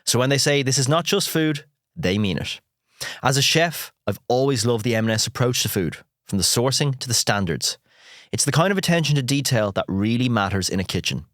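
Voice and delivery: upbeat sponsor read; bright, polished advert delivery. Acted.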